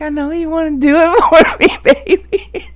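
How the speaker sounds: high, loud giggling sweet talk; high laughing baby talk; laughing coax. Retold or imitated. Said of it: "I'm like".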